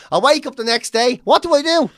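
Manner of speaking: ordinary delivery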